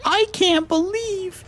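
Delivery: very high falsetto breaking into a whisper; falsetto girl voice. Acted. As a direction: push the girl voice until it cracks. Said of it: "I can't do a girl's voice".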